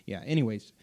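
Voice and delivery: ordinary delivery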